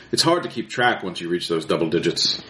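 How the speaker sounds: ordinary talk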